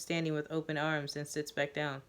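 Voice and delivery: plain reading voice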